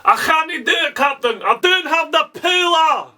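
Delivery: mock Scottish ship's engineer pleading with the captain; Scottish accent. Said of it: "This is how you do a Scottish accent".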